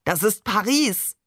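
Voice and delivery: excited call rising high on the repeat; exclaiming. A narrator's reading. Barbe shows off Paris to the family, exclaiming. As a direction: proudly call out the city's name. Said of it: "rief Barbe".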